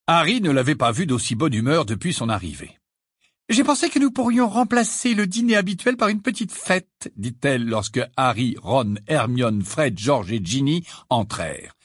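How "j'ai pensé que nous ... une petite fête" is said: high, lilting mother voice; cheerful mother. A narrator's reading. Mother welcoming the family to a little party, cheerful. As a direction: announce it brightly in a high motherly voice.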